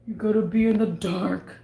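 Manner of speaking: odd put-on character voice. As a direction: slip into a silly voice. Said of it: "the voice came out".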